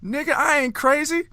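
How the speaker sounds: high, loud comic denial; imitating an offended yell; hammed-up uncle insisting he isn't Florida man; mock indignant shout. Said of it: "Cat Williams goes".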